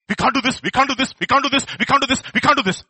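high, loud repeated chant of defeat; crowd protesting loudly. Acted. Everybody saying they cannot do it, loud high protest. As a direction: insist on it, high and loud, over and over.

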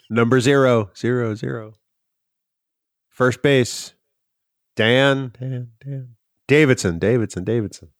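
ballpark announcer with echo; over a PA system. Pretend. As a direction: boom it out, words echoing away. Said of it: "That's how it goes at Fenway Park".

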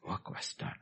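hushed, settled conclusion; whispered. Shown as a demonstration. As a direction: work breathed voiceless, very soft. Repeated line, whispered.

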